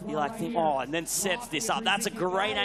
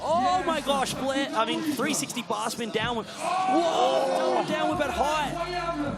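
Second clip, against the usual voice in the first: raised pitch, swelling into amazement; excited live commentary; 5 semitones higher than usual. Real, not acted. Commentator watching Logan land, rising excited play-by-play. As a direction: call the tricks as they happen, astonished.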